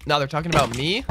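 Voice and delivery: normal talking voice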